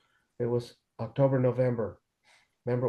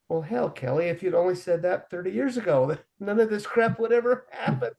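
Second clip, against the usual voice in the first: mock-rueful, higher, laughing at the end; sarcastic; 6 semitones higher than usual. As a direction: needle her with a grin you can hear. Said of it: "That was sarcasm".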